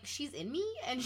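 ordinary talk, nothing marked

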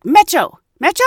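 high, loud repeated question; insistent guard call. Retold or imitated. Gate guard insisting to the confused couple, loud repeated call. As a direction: push the word at them again.

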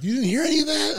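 high, amazed question; amazed his friend heard nothing. Retold or imitated. George amazed he slept.

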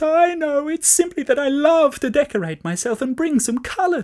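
high, loud, breathless pleading; sobbing sheep begs the farmer, high and loud; tearful pleading. A narrator's reading. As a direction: babble apologies through tears. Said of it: "burst out in a flood of tears and pleading".

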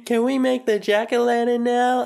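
put-on high childlike voice, cheerful; little kid voice. Son Billy greets dad in a high kid voice. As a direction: play the eager young son coming home.

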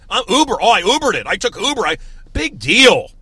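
high, loud smug bragging; mock a show-off; mocking brag. Mocking bragging Uber users.

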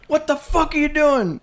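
high, shocked shout; retold yell. Teller yelling at the stranger reaching for his ankle, shocked shout.

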